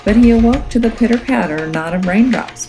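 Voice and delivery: plain reading voice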